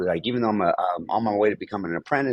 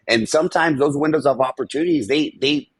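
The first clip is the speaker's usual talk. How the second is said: ordinary talk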